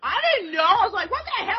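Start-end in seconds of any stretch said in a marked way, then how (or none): 1.1-1.6 high-pitched disbelief; mock-horrified high voice; startled retold question. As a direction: squeak it in alarm. Retells shock.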